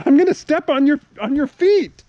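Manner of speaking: loud, high-pitched warning; exasperated warning. Real, not acted. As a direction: yell a warning at the dog underfoot. Threatening the dog while hopping.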